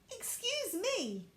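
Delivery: high, slow little voice; timid newborn doll; tiny doll voice. Acted. Ruby the doll speaking first, tiny high and slow.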